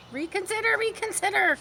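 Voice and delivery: high pleading cry; crying goose. A narrator's reading. Goose pleading with Wilbur, high. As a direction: beg him to stop. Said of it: "cried the goose".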